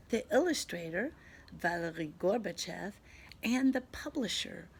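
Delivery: ordinary delivery, nothing marked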